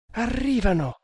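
high, frightened alarm; broken voice. A narrator's reading. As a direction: warn with the voice breaking in fear. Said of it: "proseguì la giovanetta con voce spezzata". (con voce spezzata e acuta)

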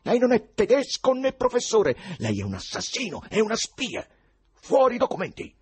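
high, loud enraged accusation; furious yelling. A narrator's reading. Ivan accuses the foreign professor of spying, furious yell. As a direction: hurl the accusations at full pitch. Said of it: "urlò infuriato Ivan".

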